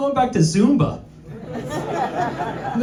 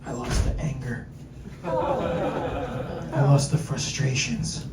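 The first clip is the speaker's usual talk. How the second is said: soft, slow, breathy guru; sighing self-satisfied fitness guru, soft and slow; serene guru voice; 4 semitones lower, 7 dB quieter, slower than usual. Retold or imitated. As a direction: preach inner peace gently.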